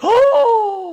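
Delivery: sudden high gasp; high excited gasp. Acted. Hearing about the ship, a high excited gasp. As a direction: gasp in delight.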